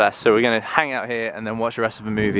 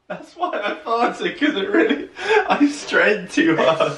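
joking; 7 semitones higher than usual. Pretend. Jokey high voice.

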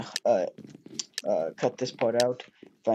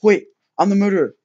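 excited realization; 8 semitones higher, 11 dB louder, faster than usual. Real, not acted. Discovers he is the murderer.

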